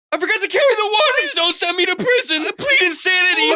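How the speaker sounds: high, fast frantic shrieks; panicked screaming. Acted. Panicking monkey fearing prison.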